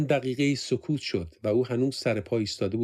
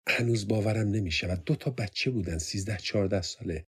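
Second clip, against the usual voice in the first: ordinary delivery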